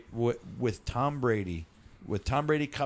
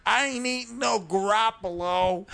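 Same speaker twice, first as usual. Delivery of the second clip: loud, high Boston tough guy; Boston accent; 8 semitones higher, 4 dB louder than usual. Pretend. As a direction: bark it like a Boston townie. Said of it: "sound like a sandwich in Boston".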